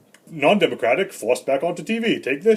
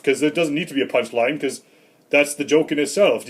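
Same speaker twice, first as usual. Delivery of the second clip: ordinary talk, nothing marked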